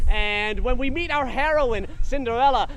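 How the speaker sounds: usual speaking voice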